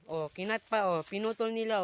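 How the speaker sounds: usual speaking voice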